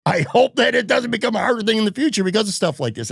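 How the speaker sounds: high-pitched, overdone mock hopefulness; sarcastic. Real, not acted. Co-host joking about future media access, sarcastic. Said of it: "I'm being sarcastic".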